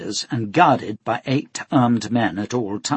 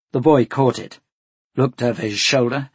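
plain reading voice

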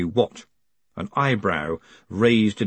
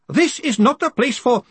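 high, raised protest; protesting Greenyham; 5 dB louder than usual. A narrator's reading. Greenyham tries to avoid a public hearing, high protest. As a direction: object in a high voice until cut off. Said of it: "Greenyham tried".

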